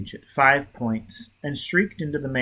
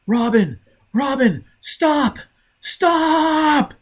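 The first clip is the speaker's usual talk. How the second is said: much higher, loud repeated shouts; shouting; 7 dB louder, much slower than usual. A narrator's reading. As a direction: yell after her to stop.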